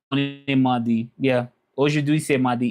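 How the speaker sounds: ordinary delivery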